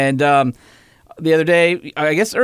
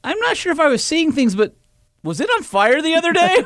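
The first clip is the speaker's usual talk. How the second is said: much higher, hesitant mock lady voice; curious lady passer-by; 13 semitones higher than usual. Dad imitating the lady asking about the fire, high timid voice. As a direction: play a timid neighbour asking carefully. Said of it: "She goes".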